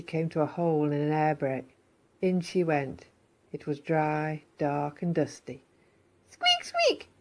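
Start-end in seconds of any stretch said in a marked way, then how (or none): 6.3-7.1 high squeak, then slow curious question; squeak, then wonder aloud slowly; squeaky mouse. Acted. Missus Mouse exploring the dark hole, squeaky and curious.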